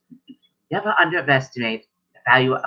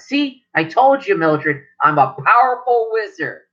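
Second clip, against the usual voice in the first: boastful grandfather; 6 semitones higher, slower than usual. Acted. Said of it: "He goes".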